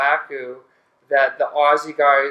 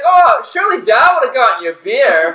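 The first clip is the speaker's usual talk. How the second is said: high, loud teasing call; mock heckling shout; 8 semitones higher, 8 dB louder than usual. Storyteller plays a friend calling from the other room, high mock shout. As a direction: call out in exaggerated mock indignation.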